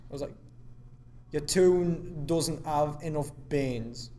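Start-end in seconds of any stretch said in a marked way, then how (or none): 1.3-4.1 slow, drawn-out mock Geordie; Geordie accent. Shown as a demonstration. Trying the sentence for co-host, slow Geordie accent. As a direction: put on a broad Geordie Shore accent. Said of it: "a Geordie Shore accent".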